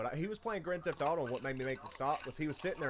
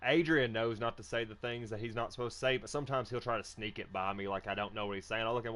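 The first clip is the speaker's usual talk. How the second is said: ordinary talk, nothing marked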